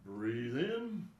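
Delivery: calm, spaced-out breathing instructions with long pauses; guided breathing cues. Acted. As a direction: guide the class through each breath, unhurried. Leads breathing exercise.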